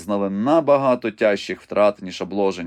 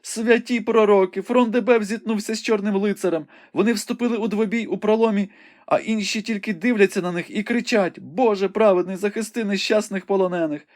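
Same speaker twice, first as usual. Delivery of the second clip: Rebecca exclaiming; 12 semitones higher than usual. A narrator's reading. Rebecca cries out to Ivanhoe at the sight, high and alarmed. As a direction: gasp it out, high and shocked. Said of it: "вигукнула".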